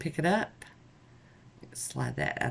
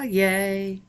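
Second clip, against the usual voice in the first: higher, louder little cheer; 6 semitones higher, 5 dB louder than usual. Real, not acted. Celebrating the finished nails for viewers, loud cheer. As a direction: cheer for yourself, loud and bright.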